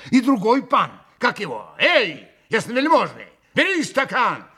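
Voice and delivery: loud, high, bustling urging; суетливо хлопоча. A narrator's reading. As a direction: fuss round the guest, calling him over. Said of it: "хлопотал Митя". (громко, суетливо зазывая)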